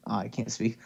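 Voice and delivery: ordinary talk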